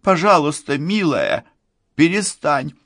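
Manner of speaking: high, desperate begging; urgent high plea; anxious pleading. Acted. Frightened parent begs child to stop.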